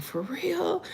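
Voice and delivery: high, soft mock-puzzled question; puzzled disbelief. Daughter-in-law answers her sarcastic father-in-law, puzzled. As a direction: squeak it softly, imitating your own confusion.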